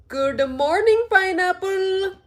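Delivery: put-on high sing-song Indian accent, pineapple slow and stretched; mock Indian accent. Streamer repeating the viral greeting for chat, sing-song mock Indian accent. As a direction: sing it like the cheery Indian man. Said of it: "trying to sing like how he sings".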